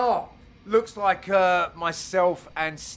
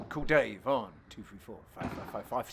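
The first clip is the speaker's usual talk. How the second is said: hushed mock advert; 8 semitones lower, 7 dB quieter than usual. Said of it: "repeats the number as a mock ad, hushed".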